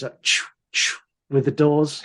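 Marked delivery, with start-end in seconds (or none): 0.2-1.0 unvoiced whooshing "choo"; two airy sliding-door hisses; imitated sci-fi door noise. Film fan mimics Star Trek doors on a clean room, airy whoosh. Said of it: "does the Star Trek noises".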